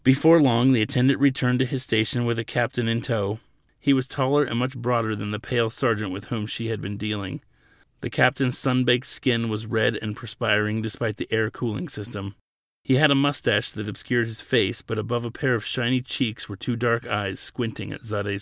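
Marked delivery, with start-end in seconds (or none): none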